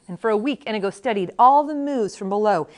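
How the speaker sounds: ordinary delivery, nothing marked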